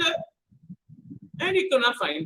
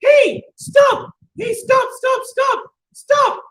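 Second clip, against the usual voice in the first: high, loud teapot yelling stop; shouting stop; 12 semitones higher, 8 dB louder, slower than usual. Acted. Teapot in the bag shouting at the robber, high and loud. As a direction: cry out to halt the thief. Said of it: "he heard a voice".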